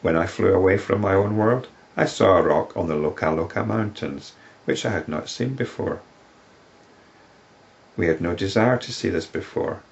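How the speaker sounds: solemn recital of the woman's plea to the sage; scripture reading. Acted. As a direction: read her words as sacred verse. Reads the woman's account.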